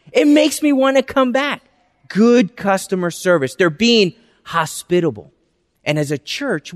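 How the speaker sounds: normal talking voice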